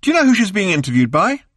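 high, loud excited call. A narrator's reading. Arthur calling Ford from the next room, excited. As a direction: shout it through to the other room.

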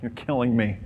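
put-on fed-up exhale; fake exasperation. Shown as a demonstration. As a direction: sigh it out as if worn down. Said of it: "Fake exasperation".

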